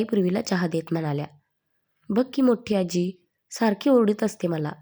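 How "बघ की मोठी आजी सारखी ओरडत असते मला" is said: ordinary delivery, nothing marked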